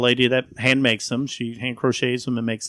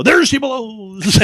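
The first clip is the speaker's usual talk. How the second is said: loud, slow pirate call; 9 semitones higher, 7 dB louder, much slower than usual. Retold or imitated. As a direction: bellow it like a pirate spotting a whale. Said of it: "Okay, in a pirate voice".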